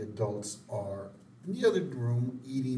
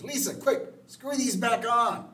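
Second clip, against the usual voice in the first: high, loud mock panic; urgent order; 11 semitones higher, 5 dB louder than usual. Mother to Lisa before the party, imitated panic. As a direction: call for help in a hammed-up fluster. Marge panics over toilet seats.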